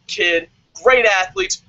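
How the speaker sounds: normal talking voice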